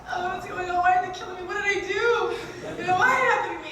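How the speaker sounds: high, loud, mock pleading; pleading whine. Phoning agents about being killed, high loud pleading. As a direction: plead with the agents, half joking. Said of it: "and I'm like".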